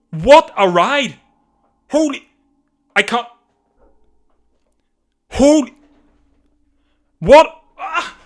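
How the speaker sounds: high, loud disbelief; can't believe what just happened; stunned shouting. Real, not acted. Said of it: "got a bit carried away".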